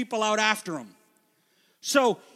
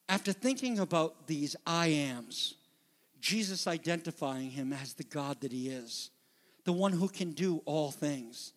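normal talking voice

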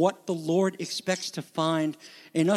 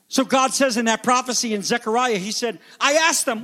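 louder, higher, impassioned sermon delivery; raised pulpit voice, building with conviction; forceful preaching; 4 semitones higher, 4 dB louder than usual. Real, not acted. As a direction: preach it hard, driving each phrase home.

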